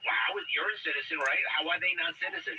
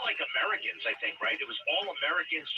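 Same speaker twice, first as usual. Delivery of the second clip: ordinary talk, nothing marked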